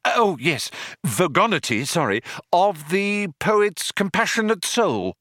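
high, hasty correction; flustered Arthur. A narrator's reading. Arthur correcting himself for the Vogon, flustered.

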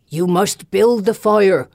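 slow, forceful elder woman's voice; commanding old mother. A narrator's reading. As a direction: order the fire built, weighty and slow. Mother Elena orders the burning.

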